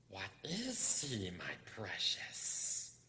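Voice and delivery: slow, breathy creature whisper; Gollum whispering. Acted. Gollum asking himself about the hobbit, whispered. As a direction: whisper it slowly to yourself. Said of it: "whispered Gollum".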